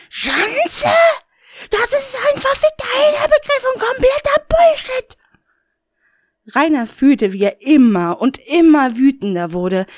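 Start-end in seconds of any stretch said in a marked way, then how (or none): 0.1-5.2 high, shrill-pitched indignant rant; furious high outburst. Acted. Rainer erupts at Death over the word. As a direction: explode with outrage.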